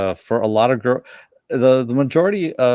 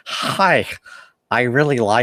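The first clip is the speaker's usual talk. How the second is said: nerd lisp; 3 semitones higher, slower than usual. Retold or imitated. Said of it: "the really bad nerd lisp".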